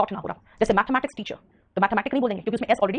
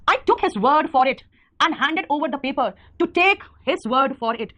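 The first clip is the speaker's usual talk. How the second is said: slow dictation; 3 semitones higher, much slower than usual. Shown as a demonstration. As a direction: drag out each word of the example slowly. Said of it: "इसका मतलब क्या है?".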